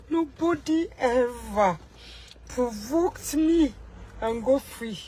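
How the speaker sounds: slow, deliberate warning, word by word; a slow, measured warning to a rival; slow spaced threat. Acted. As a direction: drag each word out slowly. Repeats threat drawn out.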